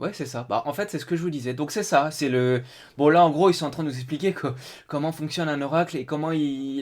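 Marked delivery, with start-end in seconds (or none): none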